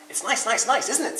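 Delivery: high, chirpy repeating bird; woodpecker voice. A narrator's reading. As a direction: chirp it like quick pecks.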